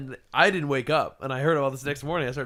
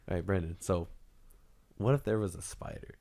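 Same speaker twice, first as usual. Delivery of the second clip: low, soft, drowsy murmur; sleepy talk; 7 semitones lower, 8 dB quieter than usual. Retold or imitated. Imitating friend sleep-talking about a spider, soft drowsy murmur. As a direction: mumble it half asleep.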